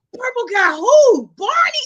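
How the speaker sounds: high, loud, mounting bewilderment; incredulous. Retold or imitated. Grandchild guessing who grandma means, mock bafflement. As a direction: act out comic disbelief, shooting up on the guess.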